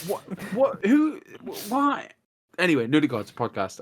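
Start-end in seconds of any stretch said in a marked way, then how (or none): 0.1-2.1 mock confusion. Host complaining to guest about clock changes, high bewildered questions. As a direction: act baffled, each word higher.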